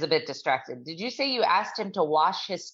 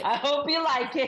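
laughing tease; 8 semitones higher than usual. Real, not acted. Teasing while laughing.

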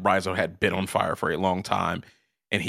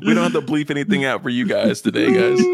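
louder, higher, through laughter; laughing; 5 semitones higher, 7 dB louder than usual. Real, not acted. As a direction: say it while cracking up. Laughing joke to viewers.

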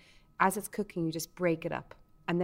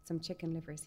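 usual speaking voice

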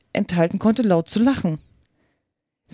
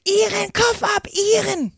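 enraged roar; 13 semitones higher than usual. A narrator's reading. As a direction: roar the order in a high, raging voice. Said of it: "fing sie an zu brüllen". (wütendes Brüllen in hoher Stimme)

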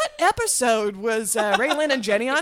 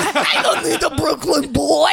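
loud, high, hammed-up Brooklyn accent; 5 semitones higher, 7 dB louder than usual. Topping a friend's line for laughs, exaggerated Brooklyn accent. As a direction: belt it out in cartoon Brooklynese. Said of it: "got Brooklyn accent now".

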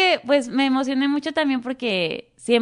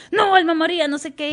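acting the aunt; 7 semitones higher than usual. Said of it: "empieza a leer a la tía".

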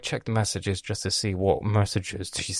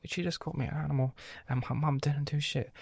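higher, fast, half-muttered fuming; 6 semitones higher, 6 dB quieter than usual. Retold or imitated. Brother fuming that mum didn't step in. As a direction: rush it out, wounded and indignant. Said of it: "I'm like".

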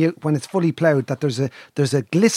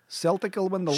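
softer, higher put-on Scottish; attempted Scottish accent; 6 semitones higher, 6 dB quieter than usual. Shown as a demonstration. Challenging a friend to do it, attempted Scottish accent. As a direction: try a Scottish football fan's accent. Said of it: "Go on, you do it".

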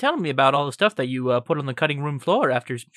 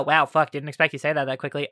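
ordinary talk

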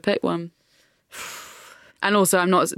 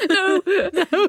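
mock wail; 14 semitones higher than usual. Mock horror at leather bed verdict.

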